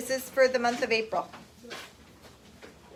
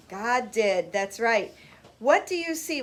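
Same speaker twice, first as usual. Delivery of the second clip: ordinary delivery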